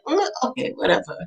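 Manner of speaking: high "Mm" dropping to soft resignation; retold doubtful agreement. Parents humoring their son's birthday plan, doubtfully resigned. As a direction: give in softly and doubtfully.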